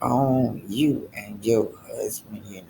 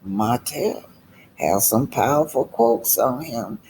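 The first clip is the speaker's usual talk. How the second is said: usual speaking voice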